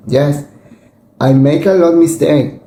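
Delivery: ordinary delivery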